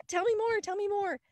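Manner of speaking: high, keen mock eagerness; eager curiosity. People eagerly asking for more.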